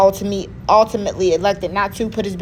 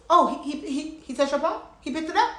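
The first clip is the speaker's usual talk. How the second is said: higher-pitched, rising disbelief; incredulous questioning; 5 semitones higher, 6 dB quieter than usual. Retold or imitated. Chess coach reacting to the picked-up pawn, incredulous. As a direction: stammer it out as a stunned question.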